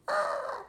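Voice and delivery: very high yelp, scared and angry at once; angry toddler yelp. Retold or imitated. Hero yelling at the bully like an angry toddler, very high yelp. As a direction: yelp like a toddler whose cookie was taken. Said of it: "but angry at the same time, like a toddler".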